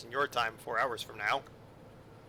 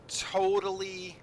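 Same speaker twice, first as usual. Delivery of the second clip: totally stretched long and pitched higher; drawn-out totally; 6 semitones higher, slower than usual. Acted. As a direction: lean into totally, slow and up. Drawn-out emphatic word.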